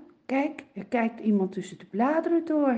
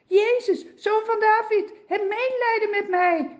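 high, loud begging cry; calling out; 11 semitones higher, 5 dB louder than usual. Acted. Blind beggar calls out to Jesus, high and loud. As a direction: cry out for mercy across the crowd. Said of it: "begint hij te dro-, te roepen".

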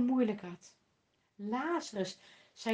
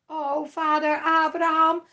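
loud, high pleading cry; the rich man presses on, loud and high; rich man insisting; 3 semitones higher, 7 dB louder than usual. A narrator's reading. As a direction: keep begging, won't let go. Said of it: "hield de rijke man aan".

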